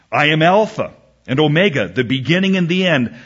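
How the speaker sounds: loud, brisk, ringing Bible verse; scripture proclamation. Acted. Preacher reads God's words from Revelation, proclaiming. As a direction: declaim it as God's own announcement.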